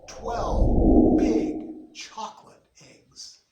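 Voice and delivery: hugely drawn-out, loud, breathy reveal; awed, stretched-out announcement; big reveal. A narrator's reading. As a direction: unveil the prize slowly, word by word. Suspenseful reveal of the prize.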